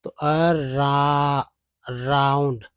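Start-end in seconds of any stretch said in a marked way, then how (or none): 0.1-1.4 "अरा-" drawn out as a... raa; broken into sounds. Shown as a demonstration. Teacher building the word around, slow separated sounds. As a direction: stretch "अरा-" slowly, stopping before the ending. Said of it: "ओ यू एन डी को आउंड बोलते हैं".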